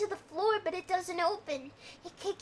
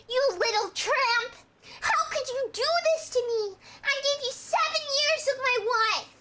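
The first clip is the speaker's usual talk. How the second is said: louder, higher, betrayed and shouting; hurt accusing outburst; 7 semitones higher, 4 dB louder than usual. Acted. Husband confronting his girlfriend about the tape, hurt and shouting. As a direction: hurl it at her like a betrayed husband. Johnny's jealous outburst read.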